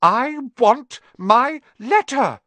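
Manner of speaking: slow, high, word-by-word yell; furious boy's demand at full pitch; shouted. A narrator's reading. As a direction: shout each word separately, loud. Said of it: "he shouted".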